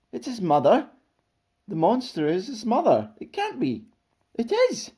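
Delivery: high, incredulous crowd chatter; amazed. A narrator's reading. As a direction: exclaim in rising disbelief. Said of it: "Everybody stared in amazement".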